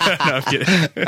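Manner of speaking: slow, laughing backtrack; amused, said through a real laugh. Real, not acted. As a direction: laugh off your own joke. Host laughs off his own joke.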